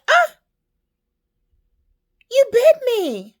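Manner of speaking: very high, loud cry of shock; shocked outcry. Acted. Woman to the snake that just bit her, shocked high shout. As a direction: yelp it, then cry out in outrage.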